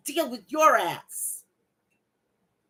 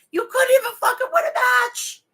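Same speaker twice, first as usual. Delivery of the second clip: much higher and louder, the voice cracking into a cry-shout on "win"; furious crying shout; 11 semitones higher, 5 dB louder than usual. Real, not acted. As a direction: scream it out, near tears with rage. Furious rant at wrestler.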